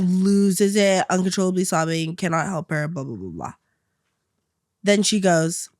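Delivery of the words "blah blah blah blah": low, soft trailing blah blah; dismissive trail-off. Real, not acted. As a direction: let it fade away dismissively.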